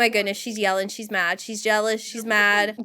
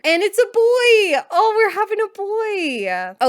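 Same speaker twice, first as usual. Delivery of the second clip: high, loud squeal of delight; real excited cry, near tears; 8 semitones higher, 4 dB louder than usual. Real, not acted.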